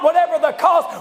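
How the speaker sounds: shouted, driving preacher's cry; preached in own words. Acted. Preacher shouting his own version to the church. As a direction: hurl it at the church. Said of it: "Let me just put it in my own words".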